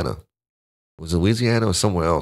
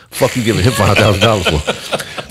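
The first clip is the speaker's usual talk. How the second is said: laughing protest; 4 dB louder than usual. Retold or imitated. Retelling protest to his brother.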